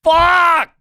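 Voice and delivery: retelling his outburst in the car, a full shout; shouted curse. Retold or imitated. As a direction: blurt it out as a real yell. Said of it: "I was like".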